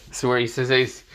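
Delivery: plain reading voice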